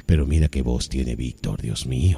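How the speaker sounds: very low booming radio voice; deep voice. Shown as a demonstration. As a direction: drop deep into the chest. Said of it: "Yo también podría hacer lo mismo".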